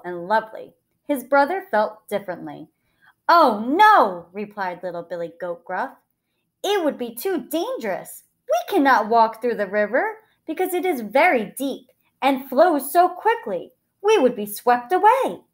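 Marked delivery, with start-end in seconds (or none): 3.3-4.3 higher, loud worried objection; voiced small goat, alarmed; alarmed little goat. A narrator's reading. 6.6-15.4 higher, emphatic warning; higher-pitched anxious character voice; worried little goat. A narrator's reading. Little goat warns of danger.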